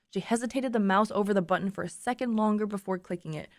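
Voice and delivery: ordinary delivery, nothing marked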